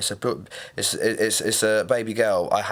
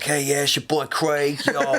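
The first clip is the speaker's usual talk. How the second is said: higher, slow hip-hop drawl; 5 semitones higher, slower than usual. Retold or imitated. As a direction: lean into the early 2000s rapper intro.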